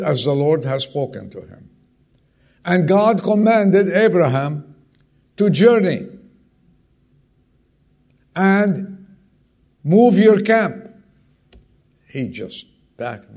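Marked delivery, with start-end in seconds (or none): 2.6-10.9 loud, high, slow preaching of God's order; booming command. Acted. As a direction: proclaim it like a thundering command.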